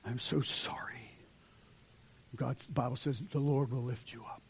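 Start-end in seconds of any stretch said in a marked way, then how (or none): 0.0-1.1 softer, whispered apology to God; a quiet, humbled sorry; hushed repentant prayer. Retold or imitated. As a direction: breathe it out like a confession. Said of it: "You come to God and say".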